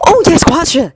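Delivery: happily certain; eager. Acted. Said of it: "Ori nodded eagerly".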